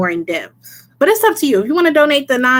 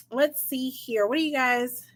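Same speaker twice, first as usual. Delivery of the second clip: ordinary talk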